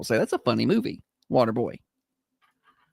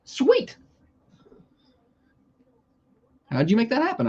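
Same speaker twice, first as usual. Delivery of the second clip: high delighted squeal; excited; 10 semitones higher than usual. Real, not acted. Excited reaction to news.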